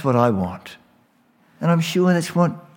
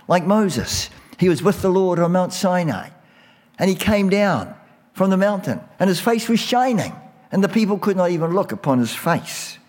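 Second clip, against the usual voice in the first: climbing higher with mounting fervor; rising preaching; 7 semitones higher than usual. Acted. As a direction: build it up like a revival preacher. Preacher rises to the Moses story.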